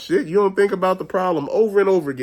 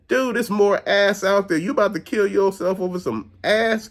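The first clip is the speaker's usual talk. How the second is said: usual speaking voice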